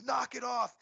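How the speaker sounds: high-pitched imitation of bystanders breaking up a fight; high mock holler; mock yelling. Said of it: "then you hear other people".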